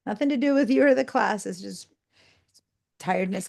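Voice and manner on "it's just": ordinary talk, nothing marked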